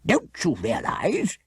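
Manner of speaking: indignant and fierce. A narrator's reading. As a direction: scold with disbelief. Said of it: "he added fiercely".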